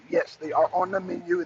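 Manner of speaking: ordinary delivery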